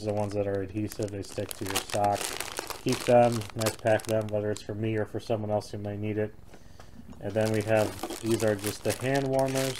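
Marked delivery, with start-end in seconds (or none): none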